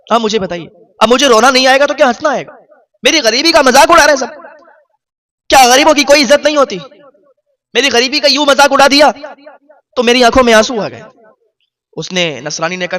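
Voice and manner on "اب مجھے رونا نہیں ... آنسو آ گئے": higher, louder cry of wounded pride; anguished outcry. Retold or imitated. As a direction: cry out at the mockery of the poor. Poor man's anguished outcry.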